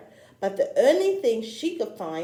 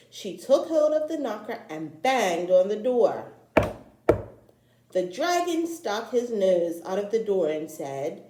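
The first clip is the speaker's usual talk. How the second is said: usual speaking voice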